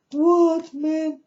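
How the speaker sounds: sudden high, loud "what, man?"; jolted by what he just heard; shocked disbelief. Retold or imitated. Stunned listener blurting out in shock. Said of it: "And I think".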